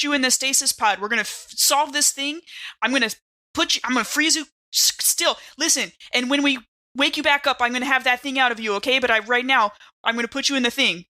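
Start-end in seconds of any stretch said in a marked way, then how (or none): none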